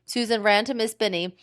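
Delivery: ordinary delivery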